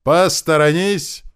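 high, loud, drawn-out bellow; roared warning. A narrator's reading. Fighter charging through the builders, roaring. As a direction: roar to clear the way. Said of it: "проревел".

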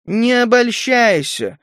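drawn-out, cutting female-character reply; mocking retort. A narrator's reading. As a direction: answer in the same mocking tone. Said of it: "в тон ему ответила Галия".

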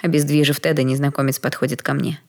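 ordinary delivery, nothing marked

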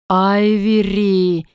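very slow, drawn-out demonic summons; eerie demon call. A narrator's reading. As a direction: draw the name out like a nightmare calling. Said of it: "этот жуткий голос". (жуткий тягучий зов демона)

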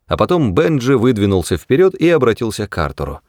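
usual speaking voice

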